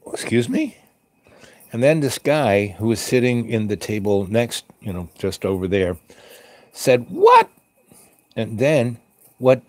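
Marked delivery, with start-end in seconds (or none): 0.1-0.8 much higher, incredulous excuse me; retelling his own shocked reply; indignant disbelief. Retold or imitated. Shocked reply to being asked to move. 7.1-7.5 very high, louder yelp of what; a startled old-timer's squeal; imitating the old neighbour's outburst; shocked old man. Old man's outraged reaction.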